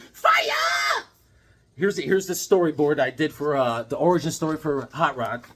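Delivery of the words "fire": very high, drawn-out yell; excited shout. Real, not acted. Boasting he is on fire with his sketch pad, excited shout. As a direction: belt it out high like a hype man.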